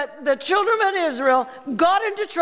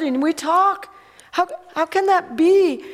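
usual speaking voice

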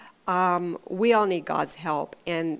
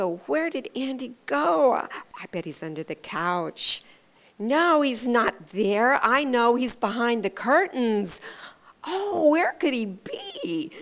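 sing-song, hammed-up mock puzzlement, higher on the questions; playful seeker; 4 semitones higher than usual. Parent pretending not to find the hiding child, playful mock wondering. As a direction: pretend to search, slow and drawn-out. Voicing herself playing hide and seek.

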